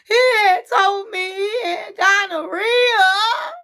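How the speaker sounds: high, loud, slow sobbing wail; sobbing confession. Retold or imitated. Faith confessing to her friends in a loud sobbing wail.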